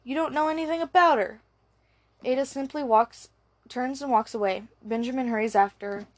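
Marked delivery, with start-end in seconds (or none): 0.0-1.4 raised, fast, higher shout. A narrator's reading. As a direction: snap it at him quickly. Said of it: "shouts Benjamin".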